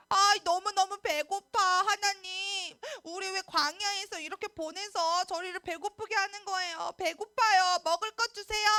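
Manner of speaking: high, loud, whimpering grumble to God; whining complaint. Acted. Israelites complaining to God about hunger, loud mock whining. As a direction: whine like hungry grumbling children. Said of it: "원망하기 시작했어요".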